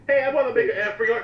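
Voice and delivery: high, loud mock voice of an eager commenter. Mocking a fan who comments on the video, high and loud. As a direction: ham up a pushy fan pitching an app. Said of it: "when people comment like that".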